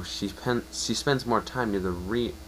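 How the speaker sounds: plain reading voice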